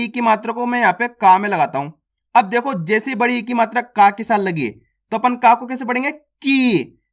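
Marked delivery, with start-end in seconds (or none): 6.1-7.0 "की" with the vowel held long; long stressed vowel. Shown as a demonstration. Teacher reading big ई on का, long stressed "की". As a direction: draw "की" out with steady force. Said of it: "यहाँ पे जोर लगा के पढ़ेंगे".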